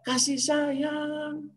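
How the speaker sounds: hushed, very slow and drawn out; tender. Real, not acted. Preacher repeating God's message on orphans, soft tender appeal. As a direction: softly stretch it into a gentle plea.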